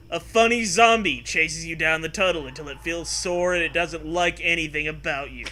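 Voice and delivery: usual speaking voice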